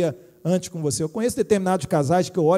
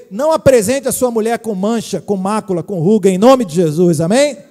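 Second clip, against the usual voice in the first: loud fervent preaching climax; loud sermon peak calling for amen; preaching at full force; 10 dB louder than usual. Real, not acted. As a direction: rise up and preach it hard to the husbands.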